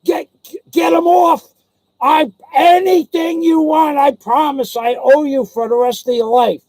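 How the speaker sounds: high, loud desperate plea; retold shout for rescue; panicked begging. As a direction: plead frantically as the trapped friend. Trapped friend begs for rescue.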